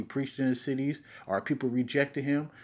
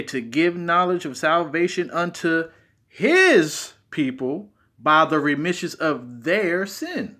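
slow, higher and louder throughout; emphatic reading; 5 semitones higher, 6 dB louder, slower than usual. Shown as a demonstration. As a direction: preach each word out. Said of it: "if you put emphasis on certain words".

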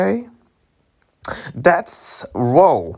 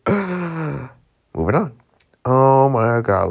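ordinary talk, nothing marked

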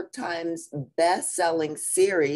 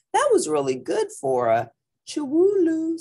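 sister voice; 12 semitones higher, 5 dB louder than usual. Acted. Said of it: "said Jujubee".

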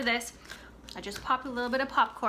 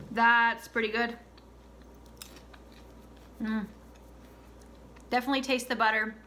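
talking with mouth full; eating while speaking; chewing popcorn; 4 dB quieter than usual. Real, not acted. Seen 2: Puts popcorn in mouth at -2 and 4.3; talks while chewing between.

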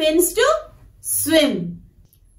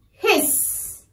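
soft hiss with long trailing s; teacher's gentle snake hiss; snake sound; 3 semitones higher, 5 dB quieter than usual. Shown as a demonstration. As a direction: stretch the s like a snake. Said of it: "How does a snake make sound".

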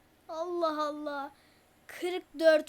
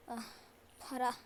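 normal talking voice

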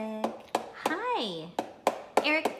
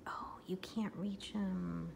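whispering; 3 semitones lower, 8 dB quieter, slower than usual. Real, not acted.

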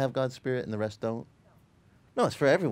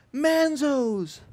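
high, joyful call across the hall; 12 semitones higher, 4 dB louder than usual. Retold or imitated. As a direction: cry out the name with open arms. Waiter runs toward them calling.

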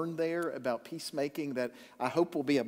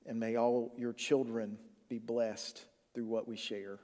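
pastoral prayer; 3 semitones lower than usual. Acted. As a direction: pray reverently, unhurried. Leads congregation in opening prayer.

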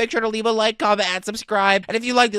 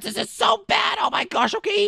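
panicked yelling; 11 semitones higher than usual. Real, not acted. Boss shooting at them.

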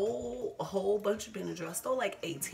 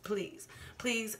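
ordinary talk

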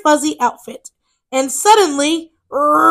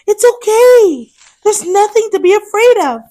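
reassuring; 7 semitones higher, 4 dB louder than usual. Acted. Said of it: "said Fiona".

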